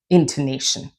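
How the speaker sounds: ordinary delivery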